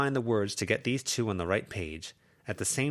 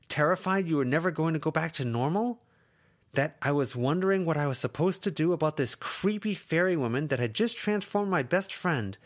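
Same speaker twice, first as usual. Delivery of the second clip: high, louder, pained; upset outburst; 11 semitones higher, 4 dB louder than usual. Acted. Lucy to Verona, upset outburst. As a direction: pour out the fear at her. Lucy venting fear at friend.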